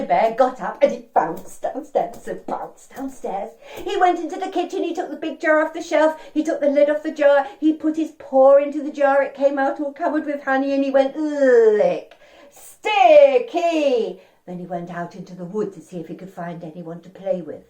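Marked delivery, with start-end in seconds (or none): 3.8-11.2 held high and rolling along without a break; excited story routine. A narrator's reading. Storyteller races children through Bear's honey routine, high and steady. 11.2-14.2 long slurpy lick, then a loud high stretched exclamation; drawn-out honey licking. Acted. Storyteller leads children licking sticky honey, loud and drawn-out.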